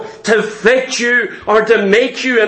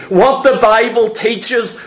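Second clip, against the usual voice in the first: ordinary delivery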